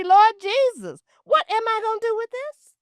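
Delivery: high, loud appeal to the Lord; mock despairing plea over a raggedy house; exasperated cry to heaven.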